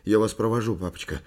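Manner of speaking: unsettled son, starting loud; voice not his own. A narrator's reading. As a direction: offer it in an unsettled voice. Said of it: "каким-то не своим голосом".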